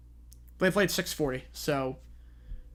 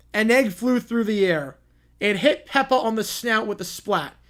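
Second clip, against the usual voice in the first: ordinary talk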